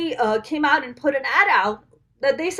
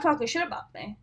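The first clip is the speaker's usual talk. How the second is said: ordinary talk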